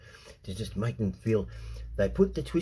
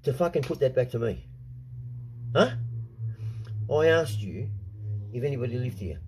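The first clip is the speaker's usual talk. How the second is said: angry retold rant; 3 semitones higher than usual. Telling the man off at the front door, angry. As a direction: bark it back at him, incredulous. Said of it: "I said".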